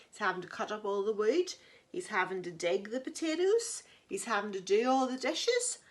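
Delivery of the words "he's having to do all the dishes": ordinary delivery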